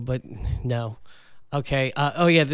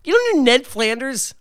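Ned Flanders; 10 semitones higher, 3 dB louder than usual. Acted. Impression guessing game.

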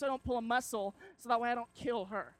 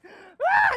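put-on high girlish scream; 17 dB louder than usual. Girl caught as pit opens.

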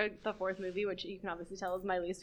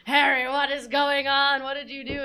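louder, slow, alarmed demand; frantic; 5 semitones higher, 8 dB louder than usual. Retold or imitated. Movie Dumbledore confronting Harry, louder and alarmed. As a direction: burst in and demand it urgently. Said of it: "he runs in and he's like".

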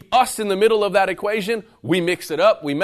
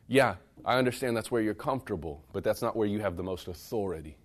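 ordinary talk

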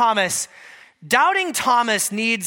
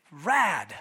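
low, drawn "Rad" with a laugh in it; a lazy surfer-dude "Rad" with a chuckle; laid-back surfer "Rad"; 6 semitones lower, 3 dB quieter than usual. Retold or imitated. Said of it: "in Aramaic".

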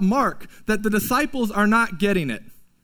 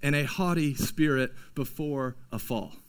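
slow, weighty recitation, fading softer; slow, grave proverb reading; solemn proverb; 5 semitones lower, 4 dB quieter, slower than usual. Shown as a demonstration. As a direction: intone it like an announced proverb. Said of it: "we interrupt this program to bring you some wisdom literature".

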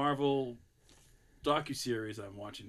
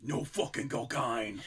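slow, hushed Irish English growl on "No fucking cocaine"; imitating Irish English accent; slower than usual. Retelling the scene's best line, slow Irish English delivery. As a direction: drag it out, half-breathed Irish English. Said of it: "just the way he says it".

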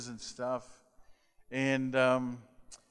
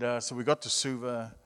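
ordinary talk

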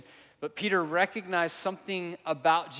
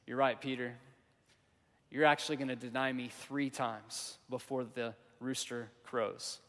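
gentle reply; 4 semitones lower, 6 dB quieter than usual. Retold or imitated. Jesus gently answers Peter.

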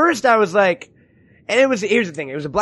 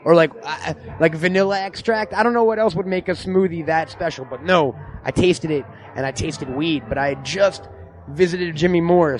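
live reporter style; 5 semitones lower than usual. Acted. Live from the comic shop to the audience, reporter voice. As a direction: play it like a field reporter going live at an event.

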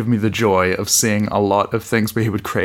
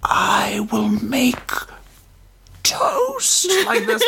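very slow, much higher strained whisper; Eddie Redmayne's character; 13 semitones higher, much slower than usual. Pretend. Joking about ordinary lines, high slow whisper. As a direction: whisper it up high like a space emperor. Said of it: "just do Eddie Redmayne's character".

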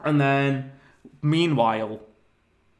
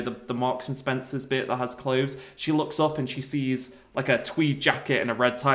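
normal talking voice

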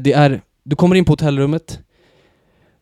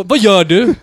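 calling out; 6 semitones higher, 7 dB louder, slower than usual. Retold or imitated. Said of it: "jag bara".

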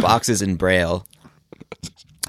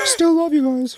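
very high, warped robotic voice; glitchy jumping pitch. Pretend. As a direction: sound like a glitching recording. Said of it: "why do you sound so weird?".

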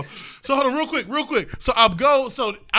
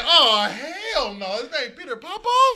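slow, then higher and laughing; laughing disbelief; 5 semitones higher, slower than usual. Retold or imitated. Telling friends about the name, laughing in disbelief.

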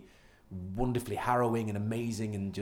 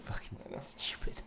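whispering; 9 dB quieter, slower than usual. Real, not acted. Muttered aside during chat.

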